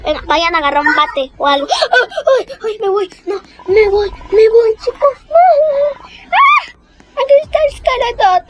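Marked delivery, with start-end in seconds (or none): 1.7-8.4 high-pitched mock-scared squeals; hammed-up frightened yelps; playful panic. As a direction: panic at a game monster, half in fun. Fleeing the villain in the game.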